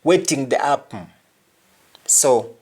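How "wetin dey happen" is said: "Waiting the happen" as "wetin de apm"; Nigerian pronunciation. Shown as a demonstration. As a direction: hum the end of HAPPEN. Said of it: "Just like Nigerians say".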